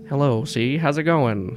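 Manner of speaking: side-mouth New York talk round a cigar; Gordon Pym. Acted. Older stoic boss greets a visitor, slight New York accent. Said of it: "he has, like, this slight New York accent".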